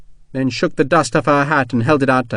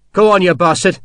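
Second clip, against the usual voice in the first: daring; 6 semitones higher, 4 dB louder than usual. Acted. Soldier dares armed attacker.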